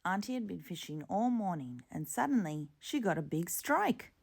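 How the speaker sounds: plain reading voice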